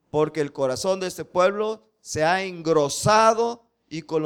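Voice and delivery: slow, loud preaching cadence; solemn scripture reading. Acted. Preacher reading Paul's words to the church, slow and loud. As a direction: proclaim each phrase as holy writ. Reads scripture at full force.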